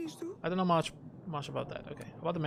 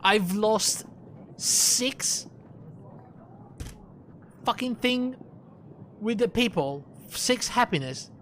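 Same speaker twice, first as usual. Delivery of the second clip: raised, exasperated rant at the game; frustrated outburst; 4 semitones higher, slower than usual. Real, not acted. Player raging at game outcome.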